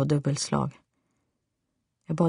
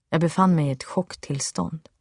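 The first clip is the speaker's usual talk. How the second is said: usual speaking voice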